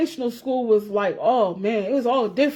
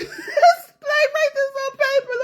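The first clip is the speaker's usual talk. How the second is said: "write this on paper" stretched slowly, much higher, laughing through it; laughing mock whine; much slower than usual. Recalling notes with the African classmate, laughing mock whine. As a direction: squeal "write this on paper" out slow while cracking up. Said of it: "I used to be mad as hell".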